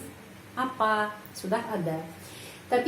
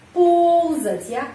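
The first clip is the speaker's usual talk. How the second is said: ползать slow, raised and loud on the first о; stress on ползать; 7 semitones higher, 6 dB louder, much slower than usual. Shown as a demonstration. Teacher showing the stressed о in ползать. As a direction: stretch the о in ползать. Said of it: "Penekanannya di sini".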